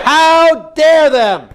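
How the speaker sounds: very slow, high indignant cry; modelled outrage. Shown as a demonstration. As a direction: stretch DARE out furiously.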